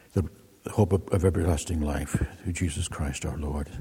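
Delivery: measured, reverent collect, softening toward the Amen; liturgical prayer. Acted. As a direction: pray it aloud for the congregation, unhurried.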